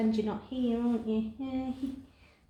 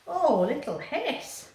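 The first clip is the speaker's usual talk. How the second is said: soothing; 8 semitones higher, slower than usual. Real, not acted. Cat hisses, gentle reply.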